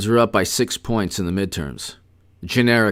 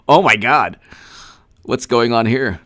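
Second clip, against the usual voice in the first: usual speaking voice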